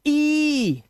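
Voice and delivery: ई high and held; long vowel sound. Shown as a demonstration. Teacher showing the EI sound, long high ई. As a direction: hold one long, level ई. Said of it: "long vowel sound निकलेगी".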